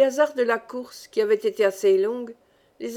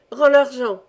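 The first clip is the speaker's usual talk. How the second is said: louder, insistent order; insistent demand; 2 semitones higher, 7 dB louder than usual. A narrator's reading. Lebrac searches the thief's pockets for money, insistent. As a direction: demand it back from a captured thief. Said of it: "insistait Lebrac".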